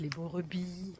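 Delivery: usual speaking voice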